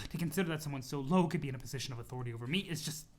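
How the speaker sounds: normal talking voice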